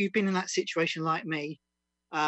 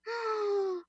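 sharp high gasp of mock panic; high comic gasp. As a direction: gasp as if fainting from the strain. Mock collapse in poem.

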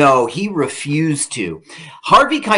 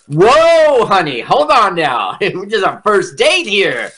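startled guy; 6 semitones higher, 3 dB louder than usual. Retold or imitated. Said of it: "And he's like".

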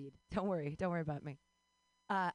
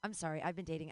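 usual speaking voice

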